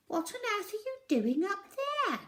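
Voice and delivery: put-on high, reasonable old lady query; a puzzled old customer; lightly voiced old lady, reasonable; old lady inquiring. A narrator's reading. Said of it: "inquired the old lady quite reasonably".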